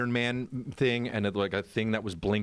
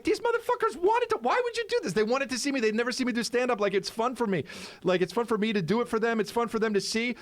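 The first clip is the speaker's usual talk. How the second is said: high-pitched indignant rant; worked-up, aggrieved venting; pissed off; 12 semitones higher than usual. Retold or imitated. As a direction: reenact being furious, pitched way up. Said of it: "and I'm pissed".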